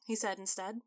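usual speaking voice

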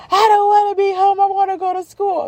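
very high, wailing mock sob; crying child. Daughter cries on a weekend for school, mock wail. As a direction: imitate a kid wailing in despair.

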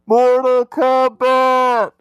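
loud, drawn-out high yell; Mortal Kombat yell. Shown as a demonstration. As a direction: scream it like the nineties techno song. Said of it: "but I'm going to pretend to do it".

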